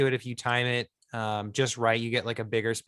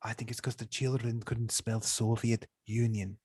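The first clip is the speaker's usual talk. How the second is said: softer put-on Soviet citizen voice; Russian comrade; 6 dB quieter than usual. Comrade explaining the name change to Russia, softer mock Russian. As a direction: confide a theory as a mock Russian, quietly.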